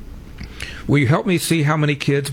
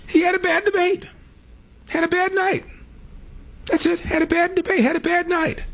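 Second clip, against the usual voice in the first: high, put-on mocking voice; mocking Kamala Harris. Host mocking what Kamala Harris said after the debate, high mock voice. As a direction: mimic her in a high silly voice. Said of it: "What did Kamala Harris say".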